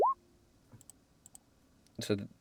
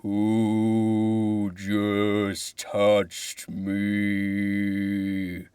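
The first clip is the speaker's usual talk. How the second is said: slow, drawn-out voice of an ancient tree; deep tree voice; 3 semitones lower, much slower than usual. Acted. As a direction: let the tree speak slowly. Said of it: "you hear a deep voice".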